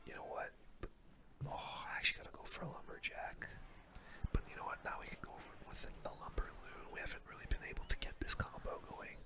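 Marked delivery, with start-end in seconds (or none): none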